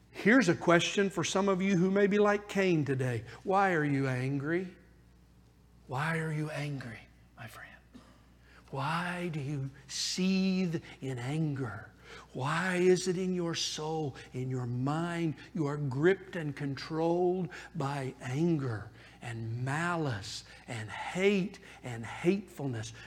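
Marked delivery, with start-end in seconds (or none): 3.4-4.7 soft question. Acted. Preacher asks the listener gently. 5.9-7.7 whispered, soft plea to a friend. Acted. Preacher whispers God's question. 8.7-22.9 slow, softer, near-whispered questioning; hushed preaching. Acted. Intimate slow preaching on anger.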